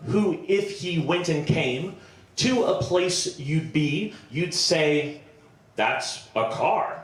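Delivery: slow, metrical poem recital; mock-grand sonnet made up on the spot; improvised mock-Shakespearean ode. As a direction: declaim it line by line, pausing to find the rhyme.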